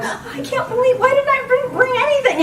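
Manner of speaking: very high, whiny self-reproach; mock despairing wail. Traveller finding her bag badly packed, mock-despairing. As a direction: wail at yourself in comic dismay. Retold despair over last-minute packing.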